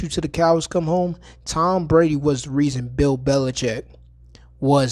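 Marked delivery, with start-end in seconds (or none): none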